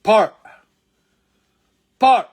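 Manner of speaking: Par with a strong aspirated P; Par, pushed out loud with air; drilled Par. Shown as a demonstration. Teacher drilling Par for students. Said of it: "فبتنطق par".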